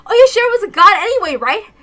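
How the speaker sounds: high loud hopeless cry; high loud despair; despairing shout. Acted. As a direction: give up, shouting. Despairing outburst.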